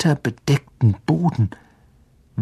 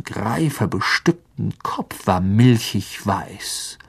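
ordinary delivery, nothing marked

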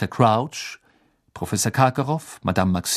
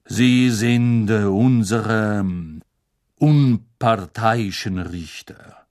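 unctuous; 4 dB louder, slower than usual. Acted. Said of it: "mit salbungsvoller Stimme".

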